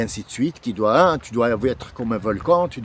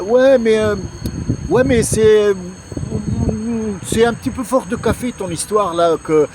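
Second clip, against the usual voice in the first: skeptical objection; 6 semitones higher than usual. Retold or imitated. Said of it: "tu me dis".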